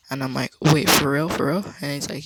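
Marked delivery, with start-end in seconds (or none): 0.6-1.6 louder, stunned double-take; surprised disbelief. Retold or imitated. Hearing from his friend about the slur, shocked disbelief. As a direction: react like you can't believe it.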